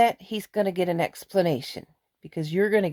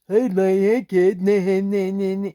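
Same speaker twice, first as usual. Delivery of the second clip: louder, put-on voice mocking the fat kid; 2 semitones higher, 5 dB louder than usual. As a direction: slur it like a mean parody of the kid. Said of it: "he didn't talk like that".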